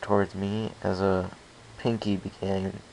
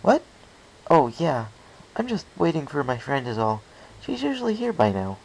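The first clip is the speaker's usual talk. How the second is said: distracted mare; 6 semitones higher than usual. Local mare answering a stranger while distracted, lightly voiced. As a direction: answer absently, mind elsewhere.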